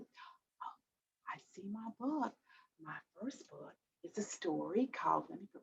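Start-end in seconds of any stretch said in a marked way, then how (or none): 0.0-4.2 whisper. Acted.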